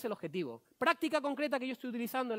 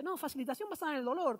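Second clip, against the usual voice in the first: higher-pitched Argentine correction; imitated Argentine accent; 6 semitones higher than usual. Colleague clarifying his method to the speaker, imitated Argentine accent. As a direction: explain it in a put-on Argentine voice. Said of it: "es argentino".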